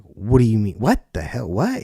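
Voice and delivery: startled rising What; retold shock. Younger self startled as the agent calls him back, surprised.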